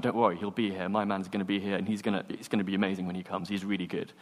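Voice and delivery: low, soft, easy reassurance; calm a worried teammate, keeping it down; quiet reassurance. Acted. Player vouching for his late mate, quietly reassuring.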